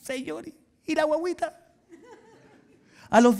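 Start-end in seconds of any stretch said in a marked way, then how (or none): 0.0-1.6 high, plaintive complaint; impatient Abraham. Retold or imitated. Abraham complaining to God about the baby, high and a touch mocking. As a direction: whine for the promised baby.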